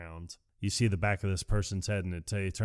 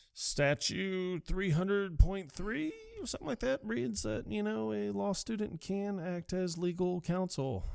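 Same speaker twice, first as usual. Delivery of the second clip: much higher, softer prim recital voice; prissy legal recital; 11 semitones higher, 4 dB quieter than usual. Retold or imitated. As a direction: parrot the know-it-all student. Mimicking Elle citing the statute.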